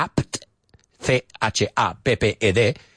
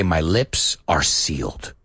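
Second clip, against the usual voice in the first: slow model phrase; 8 semitones lower, slower than usual. Shown as a demonstration. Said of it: "We say".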